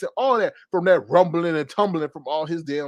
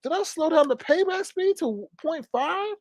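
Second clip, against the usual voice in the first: high, slow, baffled questioning; mock disbelief; 11 semitones higher, slower than usual. Host retells reacting to the slow talker, mock disbelief. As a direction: draw it out high, as if checking for a glitch.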